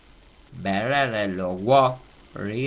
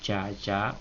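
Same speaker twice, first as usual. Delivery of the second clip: "Ya, ya" low and soft; two low, soft beats on "Ya, ya"; 2 semitones lower, 8 dB quieter than usual. Shown as a demonstration. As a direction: drop "Ya, ya" down quietly. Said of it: "otra vez volvemos bajo".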